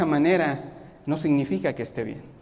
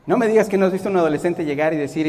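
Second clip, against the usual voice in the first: usual speaking voice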